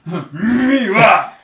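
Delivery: loud, high, very slow strained cartoon cat yell; Nyanchu voice. Shown as a demonstration. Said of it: "やっぱうまいわ".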